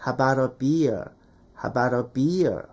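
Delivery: "how about a beer" as "habara bi-er", slow and low; linked flapped form. Shown as a demonstration. Teacher modelling the finished phrase slowly, linked with a flap. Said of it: "이렇게까지 가는".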